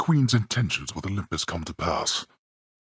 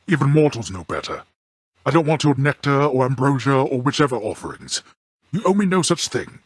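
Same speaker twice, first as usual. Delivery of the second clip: louder, higher, indignant refusal; angry rebuke; 7 semitones higher, 5 dB louder than usual. Acted. Father angrily refusing his son's offerings, raised indignant voice. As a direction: bristle and push the gifts away.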